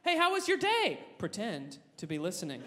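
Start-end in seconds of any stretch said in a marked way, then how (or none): 0.0-1.0 higher, eager mock interest; overly cheerful boyfriend voice; sweet question. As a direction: sound fake-attentive. Pretend boyfriend asking about day.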